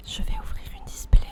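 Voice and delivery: close, breathy ASMR whisper. Pretend. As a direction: whisper it right in the ear like ASMR. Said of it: "Je vous fais un A S M R ouverture".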